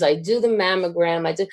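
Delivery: usual speaking voice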